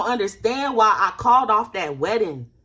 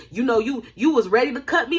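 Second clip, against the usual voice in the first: ordinary delivery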